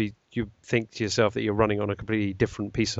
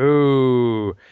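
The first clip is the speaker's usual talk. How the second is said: mock ooh; 7 dB louder than usual. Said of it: "echoes co-host's ooh".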